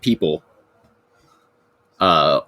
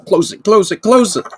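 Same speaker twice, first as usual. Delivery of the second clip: high-pitched, frantic shouted order; urgent shout; 14 semitones higher, 4 dB louder than usual. Acted. Robed stranger tumbling out of the portal, shouting. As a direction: shout it in rising alarm. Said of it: "one of them was shouting".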